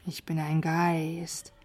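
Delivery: soft, slow, spooky wail; mock ghost voice. A narrator's reading. Hidden knight teasing a stranger, slow spooky ghost voice. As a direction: stretch the words out like a pretend ghost.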